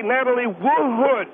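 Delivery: slow, Wood doubled as Wood-wood; mock Wood as Wood-wood; two-syllable Wood. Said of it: "a two-syllable last name".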